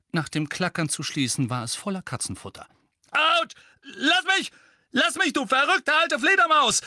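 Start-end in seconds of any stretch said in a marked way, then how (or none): 3.1-6.8 loud, high cries under blows; yelping protest. A narrator's reading. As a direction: yell to be left alone while being hit. Mundungus being beaten, cries out.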